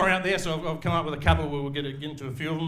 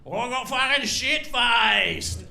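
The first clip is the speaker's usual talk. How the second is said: put-on higher, slow, shouted drunken brag; drunk son boasting; 7 semitones higher, much slower than usual. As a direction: stretch it out like a proud drunk lad. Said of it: "he was like".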